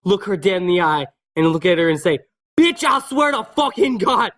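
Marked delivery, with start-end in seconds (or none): 2.6-4.3 mock menacing threat. Kid's menacing retort to teacher.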